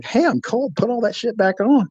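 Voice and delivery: high-pitched retold protest; indignant complaint. Complaining as clothes are stripped off.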